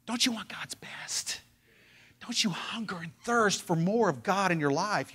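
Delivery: breathy, intense whisper to the congregation; whispered appeal. Real, not acted. Preacher pleading with the church in a whisper. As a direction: lean in and whisper the challenge.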